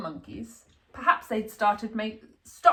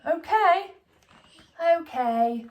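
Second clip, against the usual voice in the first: put-on little boy giving in; 7 semitones higher, 3 dB louder, slower than usual. A narrator's reading. Child giving in.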